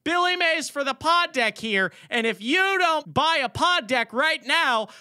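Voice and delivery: loud, high pitchman voice; Billy Mays ad read. Acted. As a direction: shout it like an infomercial host. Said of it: "the Billy Mays ad read".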